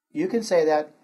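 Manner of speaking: normal talking voice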